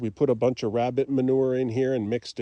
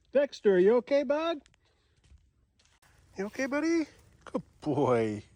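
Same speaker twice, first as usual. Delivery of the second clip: talking to a dog; 9 semitones higher than usual. Real, not acted. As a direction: coo to the dog, concerned. Checking on the dog.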